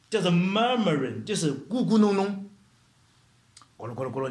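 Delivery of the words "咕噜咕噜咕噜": low, soft pigeon coos; pigeon cooing. Retold or imitated. Preacher mimics pigeons for the congregation, soft cooing. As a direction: imitate a cooing pigeon under your breath. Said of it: "你听过那个鸽子叫没有".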